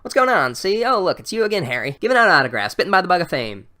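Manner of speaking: high, loud 1930s gangster voice with a "see?"; old-timey gangster. Retold or imitated. Teacher sneering at Harry's autographs, loud gangster bluster. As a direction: ham up a fast-talking mobster. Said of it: "gangster Sue Grafton comes up".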